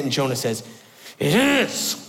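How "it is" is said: high, shouted self-pitying protest; indignant outburst. Acted. Jonah snapping back at God, indignant self-pitying shout. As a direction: whine it out as a sulking drama king.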